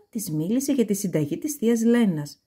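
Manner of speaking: ordinary delivery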